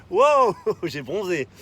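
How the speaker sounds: amused laugh, slow and loud. Real, not acted. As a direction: laugh at the surprise. Laughing at his tan line.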